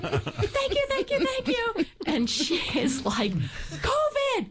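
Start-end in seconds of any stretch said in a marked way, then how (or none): 0.0-1.8 high, laughing, gushing thanks. Retold or imitated. Bear-hugging the woman in relief. 3.3-4.5 high mock alarmed cry of covid. Woman's alarmed reply in story.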